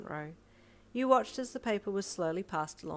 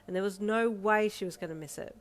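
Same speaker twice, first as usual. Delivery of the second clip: plain reading voice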